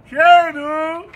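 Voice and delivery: loud rising call of the name. Real, not acted. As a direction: shout the dog's name to get his attention. Calling dog loudly.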